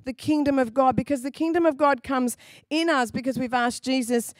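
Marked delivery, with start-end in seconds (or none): none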